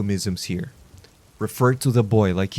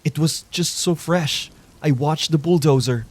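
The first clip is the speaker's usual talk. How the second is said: pleading fat man; 6 semitones higher than usual. Acted.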